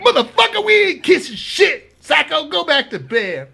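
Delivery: high, loud imitated heckle; mock angry comeback. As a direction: holler back across the tier in protest. Inmates' shouted comeback, acted.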